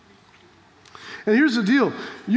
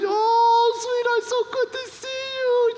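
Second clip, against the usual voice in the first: high, drawn-out squeal of greeting; imitated sweet old lady, pitched way up; delighted old lady; 12 semitones higher, slower than usual. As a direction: gush like an elderly woman greeting a dear visitor. Elderly friend's delighted greeting, retold.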